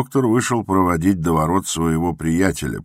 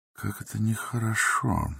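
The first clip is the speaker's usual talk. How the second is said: soft, higher private thought; inner thought; 6 semitones higher, 6 dB quieter than usual. A narrator's reading. The doctor thinks to himself, softly. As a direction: think it quietly to yourself. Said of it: "подумал он".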